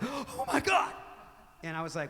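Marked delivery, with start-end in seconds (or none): 0.0-0.9 high squeal of shock; a sudden shriek of delight; gasping oh my God. Retold or imitated. Telling the crowd how she reacted: squealed in shock.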